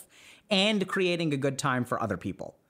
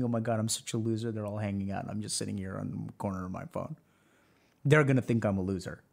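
self-pitying inner voice; 9 semitones lower, 4 dB quieter than usual. Pretend. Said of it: "And then you start thinking".

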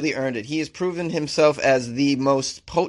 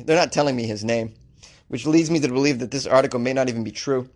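usual speaking voice

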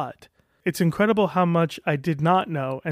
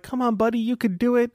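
higher, cheery pep-talk voice; upbeat, higher self-encouragement; encouraging inner voice; 6 semitones higher than usual. Retold or imitated. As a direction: cheer yourself on like a friendly buddy. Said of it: "most people have this little voice that's like".

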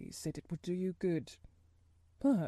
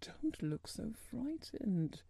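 soft, slow, worried voice; 6 dB quieter, slower than usual. A narrator's reading. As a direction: say it slowly with hushed concern. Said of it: "he said in a worried voice".

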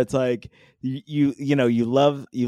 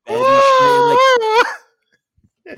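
mock shout; 5 dB louder than usual. Mad at the dog.

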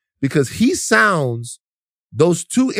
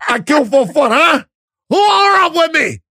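high, loud mock Jamaican rude-boy voice; loud, hammed-up Jamaican patois; Jamaican movie-gangster accent; 10 semitones higher, 4 dB louder than usual. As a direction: ham up a Jamaican gangster from Belly. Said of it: "it's like".

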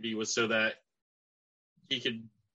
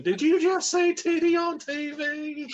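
high, slow, louder scandalized question; Jerry Jarrett impression; 5 dB louder, slower than usual. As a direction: play an outraged old wrestling boss. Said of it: "That's Jerry Jarrett impression".

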